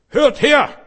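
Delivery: loud, high street-preacher shout; mock shout. Preacher mocking a street evangelist, shouting loudly. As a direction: bellow it at the crowd on the street.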